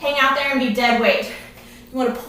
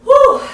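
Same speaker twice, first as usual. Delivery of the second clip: loud high blown-out breath; winded whew of relief; relieved exhale; 10 semitones higher, 9 dB louder than usual. Real, not acted. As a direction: push the air out, set done. Breather right after the set ends.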